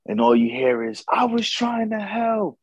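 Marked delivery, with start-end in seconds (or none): 1.1-2.6 high, slow, pleading whine; imitating a high whiny excuse; whiny defense. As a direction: play the spouse who messed up, whining. Spouse defending self in argument.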